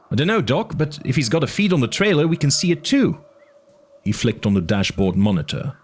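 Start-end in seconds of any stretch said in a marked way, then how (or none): 0.1-3.2 worried Eddie. A narrator's reading. Eddie's voice in audiobook.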